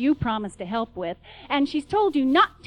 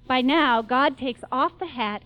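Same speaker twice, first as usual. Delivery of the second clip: ordinary delivery, nothing marked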